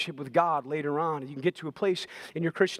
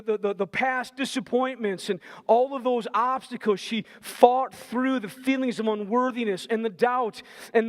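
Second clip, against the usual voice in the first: higher and louder, pounding each phrase from the pulpit; preaching at full force; 7 semitones higher, 4 dB louder than usual. Acted. Preacher rallying the congregation about the woman's determined fight, fervent and rising. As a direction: drive it home like a revival preacher, fight in every line.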